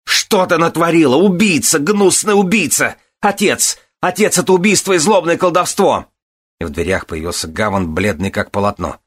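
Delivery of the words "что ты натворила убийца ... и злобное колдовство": very high, raised frantic cries; hysterical accusation. A narrator's reading. Son calling his father to witness murder, frantic. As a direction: scream murder in outrage.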